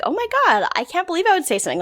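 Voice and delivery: mock surprise. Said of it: "he's kind of surprised by it".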